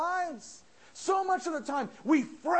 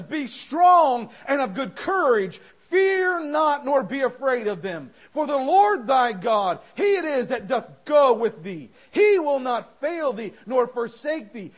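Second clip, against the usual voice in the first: louder, proclaiming King James verses; scripture read with force; 8 dB louder than usual. Acted. Preacher proclaims Moses's words, loud and solemn. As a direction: thunder the verse out.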